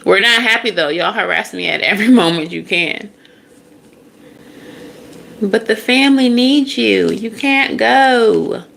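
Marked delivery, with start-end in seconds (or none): 5.4-8.6 high wail of a plea, slowing at the end; mock pleading. Family begging someone not to go, high mock pleading. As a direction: beg high and drag out the last words.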